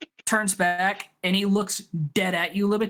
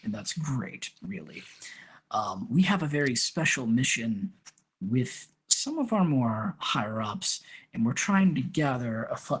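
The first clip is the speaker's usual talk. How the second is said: lower, hushed conspiratorial recruiter; Tyment's low scheming voice; 5 semitones lower than usual. Acted. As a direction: lean in and confide the secret mission.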